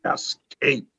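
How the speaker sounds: usual speaking voice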